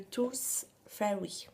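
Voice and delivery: slow, careful English pronunciation; French speaker trying an English accent; showy English accent. Shown as a demonstration. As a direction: say it in your best English accent. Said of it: "j'ai un super accent, euh, anglais".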